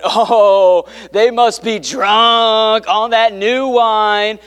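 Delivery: slow, sneering mock of drunk accusers; lightly voiced mocking onlooker; mocking scoffers. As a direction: drag it out like a jeering mocker. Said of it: "they start making fun of them, going".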